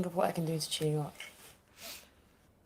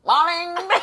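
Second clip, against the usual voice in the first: laughing, high squeal in a mock Jamaican accent; overdone Jamaican accent through laughter; 11 semitones higher, 12 dB louder than usual. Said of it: "trying to do a Jamaican accent".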